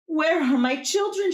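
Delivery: high anguished cry for her pumpkins. Acted. Old Juana crying out at the empty hill, high and anguished. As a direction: wail it like a mother missing her children. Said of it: "she cried".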